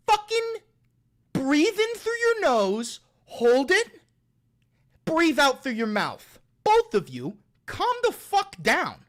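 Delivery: shouted calm-down coaching; exasperated commands. Real, not acted. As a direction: force out each instruction, at the end of your patience. Ordering feuding chatters to calm down.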